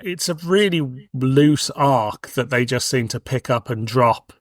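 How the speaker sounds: usual speaking voice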